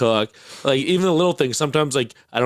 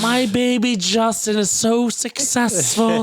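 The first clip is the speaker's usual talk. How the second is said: high, drawn-out doting mom voice; imitated proud mom; 10 semitones higher, slower than usual. Friend mimicking his mom bragging about his business, high mock gushing. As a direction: gush like a mother showing off her child.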